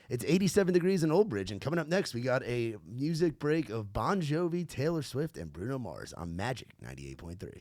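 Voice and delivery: upbeat radio announcer; bright, smiling station read. Shown as a demonstration. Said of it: "I'll try and get in character".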